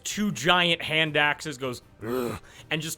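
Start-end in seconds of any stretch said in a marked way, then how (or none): 2.1-2.4 low, short wordless grunt; orcish grunt. Acted. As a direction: grunt like a huge orc. Orcish man grunts tossing satchel.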